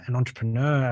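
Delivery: normal talking voice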